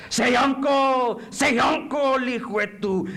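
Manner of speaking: loud, high, drawn-out command; torturer demanding surrender. Acted. Interrogator forcing a prisoner to give in, loud slow shout. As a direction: shout it slowly, twice, like an order.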